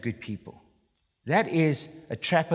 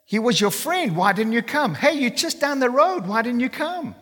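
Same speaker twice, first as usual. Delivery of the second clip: higher, pained plea to a friend; pleading sisters; 7 semitones higher than usual. Retold or imitated. Mary and Martha ask Jesus why he came too late, higher pleading. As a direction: voice the grieving sisters' complaint. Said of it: "So when Mary and Martha come to him, say".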